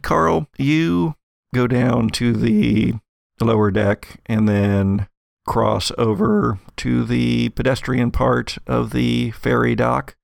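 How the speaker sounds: normal talking voice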